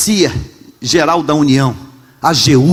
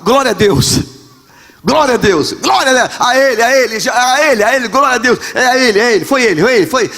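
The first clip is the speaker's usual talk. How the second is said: higher, eager praise after a goal; excited footballer; 6 semitones higher, faster than usual. Retold or imitated. Pastor imitating a footballer praising God, excited and higher. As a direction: gush thanks like a player on TV. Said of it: "o que você tem que falar?".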